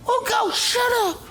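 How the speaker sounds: mock yelled dismissal; dismissive brother. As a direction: wave off the little brother. Brothers shouting David down.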